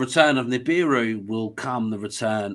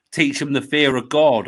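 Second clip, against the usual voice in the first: usual speaking voice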